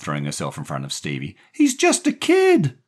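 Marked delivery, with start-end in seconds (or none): 1.5-2.8 very high, loud and slow; screamed plea. A narrator's reading. Daphne shielding the boy from Jerry, screamed plea. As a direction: cry out in his defence. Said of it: "Daphne screamed".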